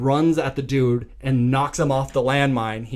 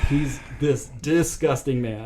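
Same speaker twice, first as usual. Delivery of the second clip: ordinary talk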